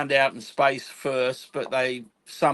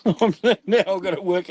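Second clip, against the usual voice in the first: laughing; 5 semitones higher, 5 dB louder than usual. Real, not acted. Laughs at own unfinished job.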